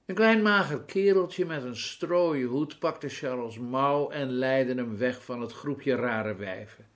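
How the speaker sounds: ordinary delivery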